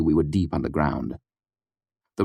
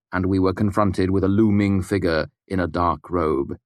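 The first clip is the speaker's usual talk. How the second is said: usual speaking voice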